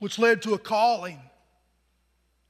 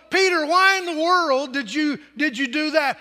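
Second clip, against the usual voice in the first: scolding wife; 5 semitones higher, 5 dB louder than usual. Acted.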